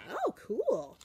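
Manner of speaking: high, bright played-up wow; mock-impressed teacher. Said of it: "And I went".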